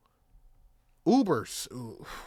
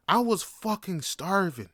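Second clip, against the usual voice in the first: normal talking voice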